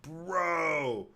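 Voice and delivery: loud, high, drawn-out "Bro"; stretched, high-pitched outburst of disbelief; amazed exclamation. Real, not acted. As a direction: cry out in amazement. Amazed at search results.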